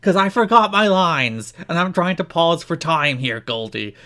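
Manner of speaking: mock self-important villain; Raven voice. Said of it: "Please do it in the voice".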